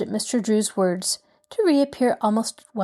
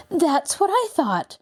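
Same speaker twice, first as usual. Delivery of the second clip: eager; 9 semitones higher, slower than usual. A narrator's reading. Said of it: "said eagerly".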